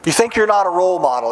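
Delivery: ordinary talk, nothing marked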